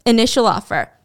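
usual speaking voice

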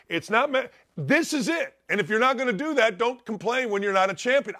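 high-pitched, insistent pep talk; coach challenging players. Pretend. Coach laying it down to players in the weight room, insistent challenge. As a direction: drive each point home like a coach.

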